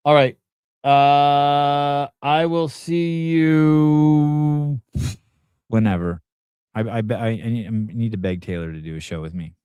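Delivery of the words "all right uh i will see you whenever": high, very slow, sustained vowels; drawn-out sign-off. Real, not acted. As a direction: stretch out the goodbye, holding "you".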